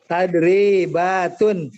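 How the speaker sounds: loud, drawn-out TADRIBATUN; slow model reading. Shown as a demonstration. Teacher showing the class the word Tadribatun, slow and loud. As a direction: stretch every syllable of Tadribatun for the class.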